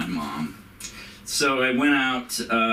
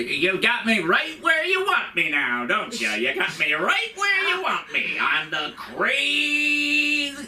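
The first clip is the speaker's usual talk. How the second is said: put-on much higher, slow sing-song old-lady taunt; mocking old mother; 13 semitones higher, slower than usual. Son imitating his mother's recurring taunt, slow and high. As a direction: drag it out like a cranky old woman needling. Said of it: "she used to always say".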